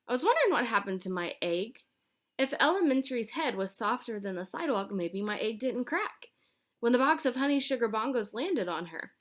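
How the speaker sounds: ordinary delivery, nothing marked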